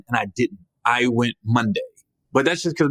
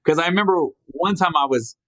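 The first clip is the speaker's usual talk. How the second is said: ordinary delivery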